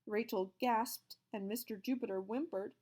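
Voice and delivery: plain reading voice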